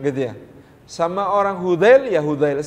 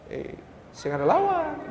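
Ambon accent; 9 semitones higher, 7 dB quieter than usual. Shown as a demonstration. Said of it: "sama orang Ambon ya tetap nadanya harus dinaikkan".

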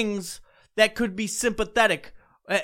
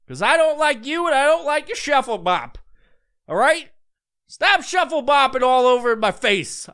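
high, raised exasperated rant; 7 semitones higher, 3 dB louder than usual. Real, not acted. Ranting at TikTok dancers, high and exasperated. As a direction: let the annoyance spill over.

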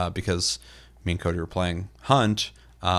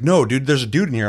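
insistent; 6 semitones higher, 7 dB louder than usual. Retold or imitated. Friend insists on enemy.

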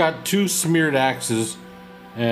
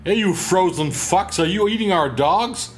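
friendly barbarian voice; 6 semitones higher than usual. Acted. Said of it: "I bellow out in my very friendly barbarian voice".

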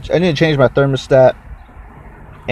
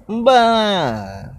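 mock blah; 9 semitones higher than usual. Dismissing the YouTube guy.

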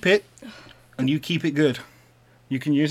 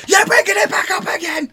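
high, loud mocking yell; mock shout; 9 dB louder than usual. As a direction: shout it like an annoyed partner. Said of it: "and I'll go".

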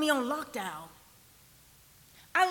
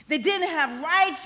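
firm, raised voice, slow and emphatic; forceful preaching; 4 dB louder than usual. Real, not acted. Preacher on children in Jesus' time, forceful and emphatic. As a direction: drive it home to the congregation.

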